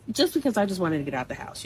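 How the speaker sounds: ordinary talk, nothing marked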